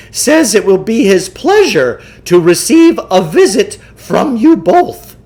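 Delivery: pompous huckster lecturing his marks; Samuel Klugarsh voice. Acted. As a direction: play the self-assured mind-control huckster. Klugarsh explains.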